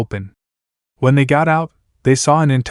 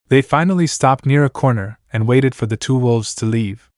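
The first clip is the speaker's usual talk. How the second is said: usual speaking voice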